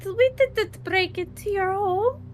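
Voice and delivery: high little rat voice, pleading; squeaky rat voice. Acted. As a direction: squeak sweetly like a tiny rat. Player rat voice, denying.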